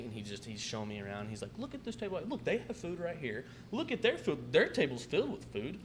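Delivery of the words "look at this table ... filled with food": high-pitched indignant imitation; mock his complaint in a high voice; mimicked pissed-off customer. Customer showing manager around other tables, mimicked indignant complaint. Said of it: "he's pissed off".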